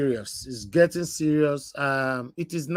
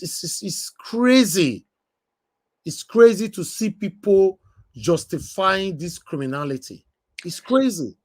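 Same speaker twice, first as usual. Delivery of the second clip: outraged; 7 semitones higher, 4 dB louder, slower than usual. Real, not acted. Outraged at people justifying crime.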